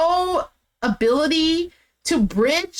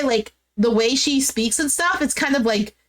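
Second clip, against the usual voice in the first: usual speaking voice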